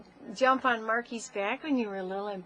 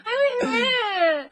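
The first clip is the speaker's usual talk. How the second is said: put-on high, loud squealing baby voice; imitating toddler; 11 dB louder than usual. Mother imitating her toddler son's frantic babble. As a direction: mimic a toddler's excited squeal. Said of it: "It was like".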